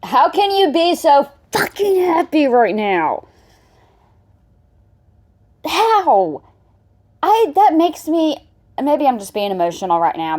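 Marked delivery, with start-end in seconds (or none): 0.0-8.5 high, drawn-out incredulous reaction; exasperated disbelief. Real, not acted. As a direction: stretch out the words in upset disbelief. Outraged reaction to clip.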